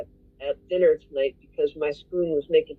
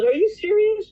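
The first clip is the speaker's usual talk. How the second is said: high, loud shocked question; disbelief; 14 semitones higher, 3 dB louder than usual. Retold or imitated. Friend shocked at not sharing the salad, high and loud. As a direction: ask it in total disbelief. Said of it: "And she goes".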